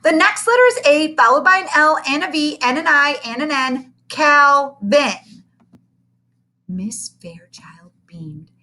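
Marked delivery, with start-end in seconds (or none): 0.0-5.4 put-on boy spells proudly. Calvin spells.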